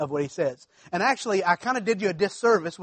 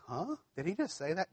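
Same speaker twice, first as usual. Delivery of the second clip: hushed, puzzled "huh?" with rising pitch; mock disbelief; 13 dB quieter than usual. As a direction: imitate a taken-aback listener under your breath. Voicing puzzled listeners' reaction.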